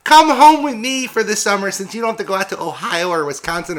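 high, loud valley-girl impression; mimicking the summer girls, high and bubbly; mock girly voice. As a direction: ham up a ditzy party girl. Mocking visiting girls' invitation.

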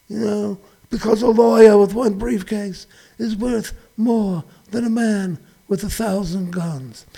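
lightly voiced immigrant parent with plans; a hint of a doting parent; proud parent. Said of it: "we're gonna work hard and sacrifice".

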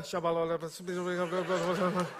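slow, drawn-out babbling "Shabalala" through a laugh; playful mimic of praying in tongues; lightly laughing mock babble. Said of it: "my mate's just standing back going".

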